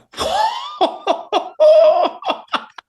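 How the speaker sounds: long, very high-pitched laughing whoop; retold burst of giddy disbelief; high amazed laugh. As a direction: let out a drawn-out, squealing laugh. Acts out amazed reaction.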